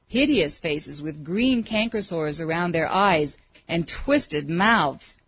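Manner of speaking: ordinary delivery, nothing marked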